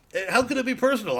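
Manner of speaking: ordinary talk, nothing marked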